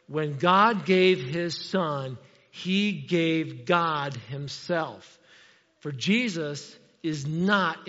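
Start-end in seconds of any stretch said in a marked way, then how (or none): 0.1-5.2 very slow, every word weighed; said carefully. Shown as a demonstration. Preacher to congregation, slow and careful on the key point. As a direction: lay it out word by word so nobody gets lost. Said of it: "so let me say it carefully".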